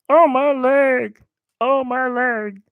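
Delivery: high slow hammed-up moan; mock pained cry. Gamer voicing hurt character, high slow mock moan. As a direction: whine about the leg like a hurt old man. Character hurts leg in game.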